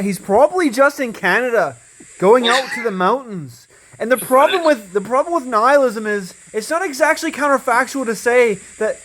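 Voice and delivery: playful send-up of a fellow debater; Cage impression. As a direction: put on a high, lilting debater's voice. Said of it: "That's my Cage impression".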